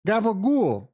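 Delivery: put-on high, loud Italian-American mob accent on Gabagool; mock Italian-American. Knows only one Italian word, so says it in a hammed-up Italian-American accent. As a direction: toss out Gabagool like a Sopranos mobster, stretching the vowels. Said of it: "I don't think I know one Italian word".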